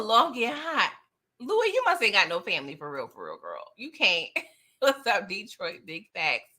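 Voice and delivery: ordinary talk, nothing marked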